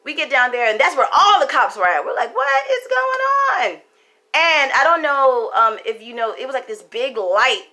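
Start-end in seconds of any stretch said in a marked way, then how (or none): 2.3-3.8 high, slow drawn-out shout of alarm. Retold or imitated. Seeing cops at the house, a slow alarmed shout. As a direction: cry out in disbelief, slowly.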